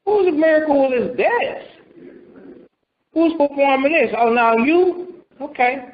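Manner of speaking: high, stung protest at being sidelined; indignant Moses. Retold or imitated. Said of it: "Well, I say".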